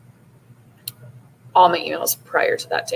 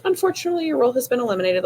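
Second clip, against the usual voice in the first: "Unfortunately" pitched much higher in a scornful sing-song; mock-cheery corporate read of "your role has been eliminated"; mocking the email; 7 semitones higher, much faster than usual. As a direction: sneer the cold line back, higher.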